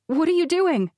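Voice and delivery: high, sharp bewildered question; mother startled, questioning Steve. A narrator's reading. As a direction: snap out of it and demand answers. Confused mother snaps to awareness.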